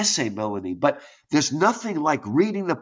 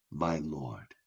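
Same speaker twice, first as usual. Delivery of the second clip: very low, hushed answer; humbled reply; 13 semitones lower, 14 dB quieter than usual. Acted. Poet answering God's call, low hushed submission. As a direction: drop right down, soft and submissive.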